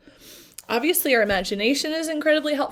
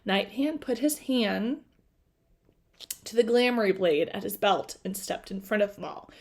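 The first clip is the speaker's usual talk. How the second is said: plain reading voice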